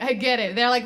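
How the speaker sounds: ordinary delivery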